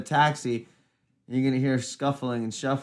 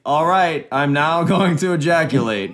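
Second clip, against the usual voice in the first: loud, raised mock announcement; mock announcer; 4 semitones higher, 7 dB louder than usual. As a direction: proclaim it like a formal announcement. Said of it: "a guy that announced".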